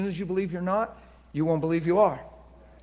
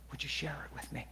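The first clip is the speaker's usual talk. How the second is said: whispered prayer; 4 semitones lower, 16 dB quieter than usual. Retold or imitated. Intimate prayer, then aside.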